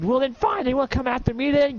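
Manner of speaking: mock tough-guy taunt; defiant. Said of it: "And you're like".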